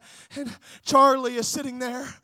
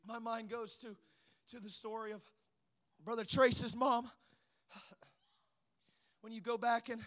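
soft, low, solemn pulpit storytelling; subdued sermon storytelling; 5 semitones lower, 7 dB quieter than usual. Real, not acted. Preacher recalling an evangelist's beginnings, subdued storytelling. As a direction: drop right down and begin the testimony quietly.

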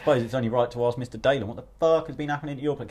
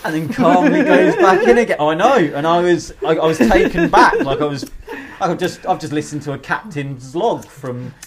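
laughing retelling; 2 semitones higher, 9 dB louder than usual. Real, not acted.

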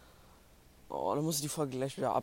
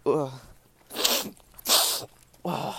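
about to sneeze; 6 dB louder than usual. Real, not acted. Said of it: "Oh, ich muss niesen".

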